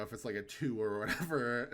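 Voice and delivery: ordinary delivery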